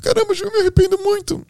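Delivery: put-on very high-pitched tearful child confession; hammed-up weepy squeal; mock crying. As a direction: imitate a girl wailing her apology. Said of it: "ela começou a chorar".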